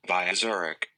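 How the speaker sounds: normal talking voice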